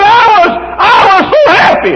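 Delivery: high, loud cry of happiness; joyful crying shout. Retold or imitated. Dying man recalls heaven to the preacher, crying out with joy. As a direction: shout it through tears of joy.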